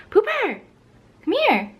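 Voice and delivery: high coaxing pet voice; calling the dog. Real, not acted.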